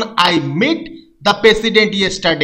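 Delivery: usual speaking voice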